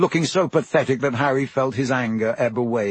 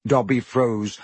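ordinary delivery

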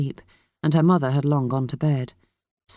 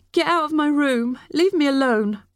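high-pitched furious dismissal; upset outburst; 10 semitones higher than usual. A narrator's reading. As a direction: drive her out, voice pitched high. Niece throws aunt out.

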